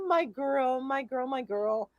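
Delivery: soft, slow, tender cooing; cooing to the dog. Real, not acted. Owner cooing softly to her dog Stella, tender. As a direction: coo it to your dog.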